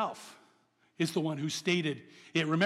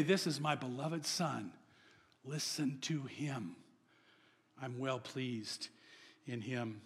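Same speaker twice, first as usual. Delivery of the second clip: slow, much softer solemn voice from above; voice from heaven; 6 semitones lower, 9 dB quieter, slower than usual. Acted. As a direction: let it fall softly, slow and holy. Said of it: "all heard the voice say".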